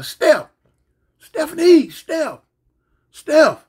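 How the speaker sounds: high, loud calls through the house; hollering her name. Retold or imitated. Man hollering through the house for his girlfriend, loud calls. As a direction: call her across the house. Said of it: "I'd go to holler".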